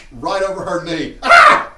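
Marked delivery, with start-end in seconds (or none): 1.2-1.7 laughing yelp. Real, not acted. Laugh-yell at spanking.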